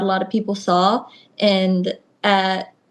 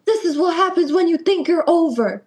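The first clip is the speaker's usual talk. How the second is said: higher scolding call down the hall; mock scolding; 8 semitones higher than usual. Mentor scolding a rookie down the hall, higher mock anger. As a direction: throw a mentor's scolding at her.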